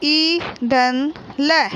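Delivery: slow, sound-by-sound क धन ई धन ल; teacher slowly joining the letters of कील; slow spelling out. Shown as a demonstration. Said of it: "तो हम".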